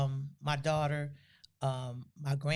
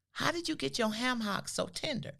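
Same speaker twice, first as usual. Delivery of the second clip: high, lightly scolding mother; voicing her mother; 7 semitones higher than usual. Daughter imitating her mother's high-pitched disbelief about cornbread. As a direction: ask it high and pointed, like a disbelieving mom.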